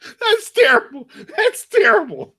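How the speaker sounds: high, squealed through laughter; real helpless laughter. Real, not acted. Listener reacting to the co-host's awful joke, laughing hard. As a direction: crack up while protesting, pitch shooting way up. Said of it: "then stop laughing at it".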